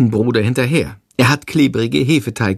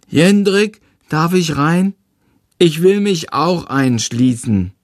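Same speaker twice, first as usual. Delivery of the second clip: put-on higher, childlike small boy voice; a little kid asking to join in; little brother; 7 semitones higher, slower than usual.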